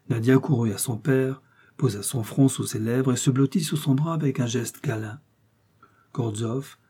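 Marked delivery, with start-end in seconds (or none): none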